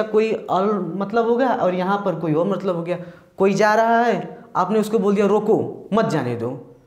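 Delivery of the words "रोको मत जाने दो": "रोको" set apart, then "मत जाने दो"; spoken as "रोको, मत जाने दो"; pause after रोको. Shown as a demonstration. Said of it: "अगर बोलेंगे आप मुंह से बोलेंगे".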